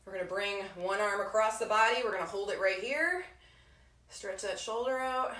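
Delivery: soft calm stretching cues; calm cool-down voice. Acted. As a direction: ease them down gently.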